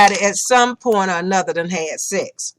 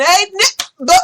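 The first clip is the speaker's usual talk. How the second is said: eager mock greeting; 10 semitones higher, 5 dB louder, slower than usual. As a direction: shout the greeting trying to fit in.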